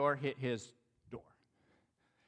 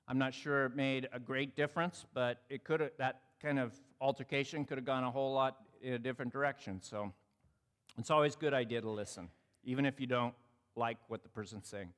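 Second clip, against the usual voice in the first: ordinary delivery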